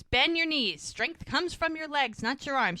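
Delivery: imitating an insistent coach. Repeats instruction after she asks What.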